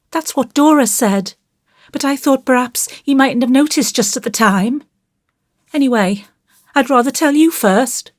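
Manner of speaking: put-on high, plaintive child's voice; troubled child, pitched high; anxious little boy. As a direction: confess like a frightened small boy. Voices Davy explaining.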